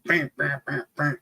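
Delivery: high, quick metallic clanks voiced; imitated banging handle. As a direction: mimic a handle bouncing on pavement. Imitating handle hitting the street.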